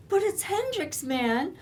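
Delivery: mock the boyfriend's starry excuse; whining excuse. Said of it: "And you're just going".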